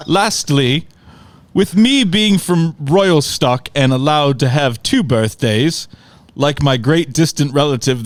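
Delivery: haughty mock London uncle demanding his due; butchered London accent. Uncle demands his birthday gift from Corey, butchered London accent. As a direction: put on a pompous, bad London accent. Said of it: "I'm sure I will butcher his accent".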